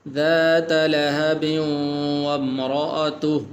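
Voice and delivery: ذات لهب carried into وامرأته without a stop; slow chant, no waqf; joined reading. Shown as a demonstration. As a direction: recite on without pausing after لهب. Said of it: "اس طرح بھی آپ پڑھ سکتے ہیں".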